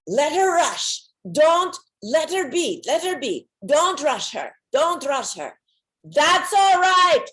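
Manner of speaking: high, loud, insistent reassurance; Swami telling others. Retold or imitated. The Swami tells the others not to rush her, loud and high. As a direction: tell the group firmly to wait for her. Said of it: "I hear him telling others".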